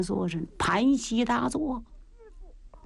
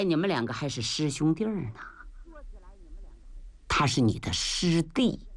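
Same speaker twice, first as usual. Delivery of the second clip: put-on old master; 4 semitones lower than usual. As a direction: speak like a calm, aged master. Old master speaks, Buddhist greeting. (老师父低沉缓慢地说话)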